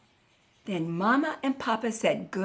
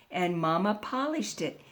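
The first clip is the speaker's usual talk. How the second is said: ordinary delivery, nothing marked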